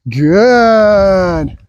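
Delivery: long, stretched-out praise word; duration marker. Real, not acted. Trainer telling dog to keep waiting, drawn-out marker. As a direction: hold the word out slowly, letting it fall. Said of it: "The good duration marker".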